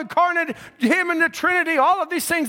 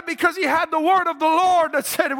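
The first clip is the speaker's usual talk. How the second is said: ordinary talk, nothing marked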